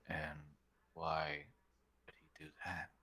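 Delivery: hushed aside under the breath; voiceless murmur to a companion; whisper. Acted. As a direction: whisper it so only one person hears. Dravis mutters aside to player.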